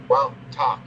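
usual speaking voice

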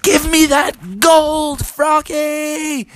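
very high, drawn-out demand; angry bear. Acted. Bear smashing at the window, angry yell. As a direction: holler it like an enraged bear. Bear attacks car for gold.

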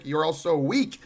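usual speaking voice

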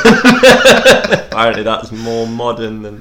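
slow, laughing and loud; laughing through words. Real, not acted. Co-host mocking Mario's Brooklyn makeover, laughing loudly. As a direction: let the laughter carry the words.

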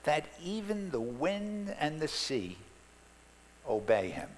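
slow, high-starting awed question; awed wonder. Acted. Disciples asking each other in awed wonder. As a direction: ask it in hushed amazement. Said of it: "said to one another".

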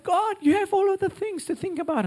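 very high, loud tearful squeal; mock crying. Imitating a crying friend on a call, high sobbing voice. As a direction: imitate a man wailing on the phone, pitched right up. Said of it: "he's crying. He's like".

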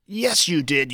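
a loud, insistent comeback; insistent retort. Retold or imitated. Partner insisting in a retold argument, loud mock retort. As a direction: snap back that they really did. Retold quarrel with significant other.

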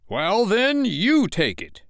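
high, slow, forceful retort; heated comeback. A narrator's reading. Father returning Michael's dare, heatedly. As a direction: lean hard on throwing it back.

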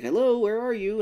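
high, loud call into the dark; a horror-movie frog calling out. Retold or imitated. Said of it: "He's like".

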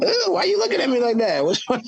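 high, fast put-upon whine; mock hurt friend. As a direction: play wounded innocence, quickly. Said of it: "you're like".

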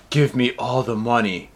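deep gravelly threat, mocked; low menacing robber voice. Reading the robber's demand to the clerk in a low menacing voice. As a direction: growl it low like a movie villain. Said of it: "did she do it in that voice?".

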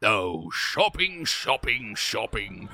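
slow, savouring each word; gleeful. Acted. Duke delighting in his shopping trip.

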